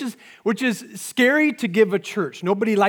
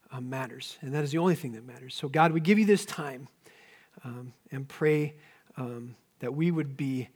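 prayer; 4 semitones lower, 5 dB quieter than usual. Acted. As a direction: pray softly and reverently. Leads congregation in prayer.

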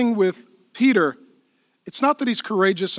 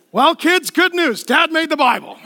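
a hint of a proud father, louder and higher; mock proud dad; 7 semitones higher, 4 dB louder than usual. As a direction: playfully voice Malchus boasting to his kids. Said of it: "Larry's like".

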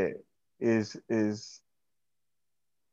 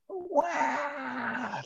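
a high, hammed-up fake crying wail; mock baby wail; 7 dB quieter than usual. Mocking entitled people, a high fake baby wail. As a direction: wail like a spoiled child, mocking.

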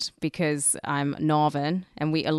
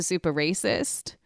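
ordinary delivery

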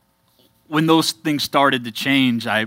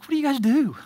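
much higher, an incredulous squeak; baffled question; 13 semitones higher, faster than usual. Retold or imitated. Pastor imitating his past self asking friends, higher and baffled. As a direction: play your own clueless past self. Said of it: "I was like".

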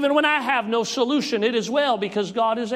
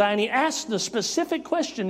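ordinary talk, nothing marked

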